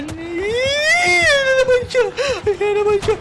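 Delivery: high, stretched-out cry of alarm; panicked wail. Real, not acted. Player warning friends it is back, frightened high wail. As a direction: wail it as the pursuer appears. Monster shows up again in game.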